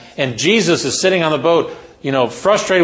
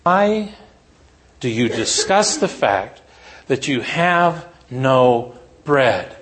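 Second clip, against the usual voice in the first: slow, dismayed question; 2 semitones lower, much slower than usual. Retold or imitated. Jesus asks his disciples, slowly drawn out. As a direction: draw each word out in disbelief. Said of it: "said to them".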